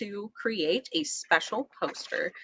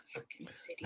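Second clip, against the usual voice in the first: hushed swear aside; whispering; 18 dB quieter than usual. Real, not acted. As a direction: whisper it under your breath.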